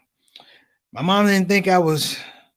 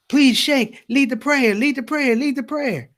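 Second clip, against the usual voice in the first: higher pleading chant; eager pleading crowd; 7 semitones higher than usual. Retold or imitated. Worshippers begging bearded man to lead prayer, higher pleading. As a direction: beg him over and over. Crowd begs fake sheikh.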